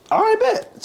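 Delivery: very high-pitched comeback; mock defiant acceptance. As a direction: toss back a high, put-on okay. Retold retort to being sent home.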